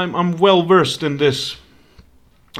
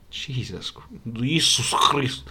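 normal talking voice

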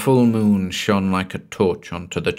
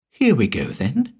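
higher, with a questioning lift at the end; matter-of-fact; 5 semitones higher than usual. A narrator's reading. Villain about to drag off his captive, matter-of-factly. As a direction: casually announce the next job. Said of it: "Mister Sliggins said, matter-of-factly".

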